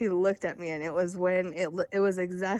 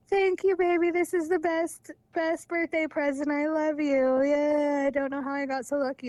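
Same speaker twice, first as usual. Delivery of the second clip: high, sing-song gushing, held on one raised note; mock gushing; 7 semitones higher, 3 dB louder than usual. Mocking the post he faked in her name, mock gushing. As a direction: imitate a sappy thank-you in a high put-on voice. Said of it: "he made it seem like I made the post".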